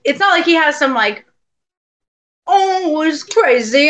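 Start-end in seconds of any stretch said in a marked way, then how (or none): 2.5-3.9 lightly hammed-up exaggerated voice, higher and louder; mock ghost accent. Joking about how the dead friend did not sound, mock voice. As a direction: toss off a silly put-on exclamation.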